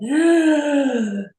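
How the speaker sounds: high, loud shocked intake of breath; sharp gasp. Retold or imitated. Mother reliving being triggered by her daughter, loud high gasp. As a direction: gasp as the button gets pushed.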